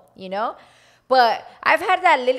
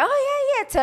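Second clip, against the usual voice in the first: very high, bright "oh yeah"; fake-sure friendly agreement; bubbly nodding along; cheery recognition. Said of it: "my face went from like".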